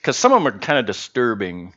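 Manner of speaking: normal talking voice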